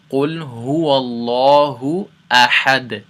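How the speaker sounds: chanted tajweed recitation; Quran recitation. Shown as a demonstration. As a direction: recite the verse with a bounced final D. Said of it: "bounce the B and the D".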